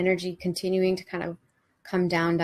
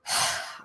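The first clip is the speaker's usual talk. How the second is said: short breathy exhale; audible cleansing breath; releasing sigh; 3 dB quieter than usual. Shown as a demonstration. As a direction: let it go on an out-breath. Demonstrates letting go through breath.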